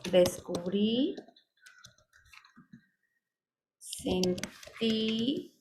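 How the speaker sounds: stretched final syllables, rising; slow word-by-word dictation. Shown as a demonstration. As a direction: draw each word out as if typing it. Typing example past-tense verbs.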